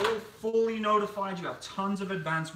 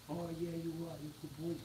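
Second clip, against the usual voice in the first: softer, murmured pet talk; cooing at the dog; 13 dB quieter than usual. Real, not acted. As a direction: coo it gently to the dog.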